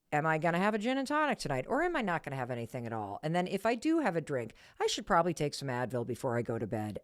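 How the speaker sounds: fast, softened self-debate; quick muttered planning to oneself; inner deliberation. Retold or imitated. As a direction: rattle through the bargaining as if thinking aloud. Acting out anxious inner bargaining.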